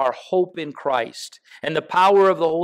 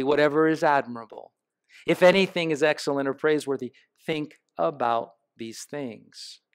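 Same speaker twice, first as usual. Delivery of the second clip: scripture reading; 4 semitones lower than usual. Acted. Reads the scripture passage aloud.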